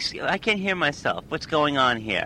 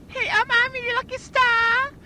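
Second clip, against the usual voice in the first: very high squeaky falsetto [name]; shrieking falsetto pop diva. Acted. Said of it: "[name] is the store owner".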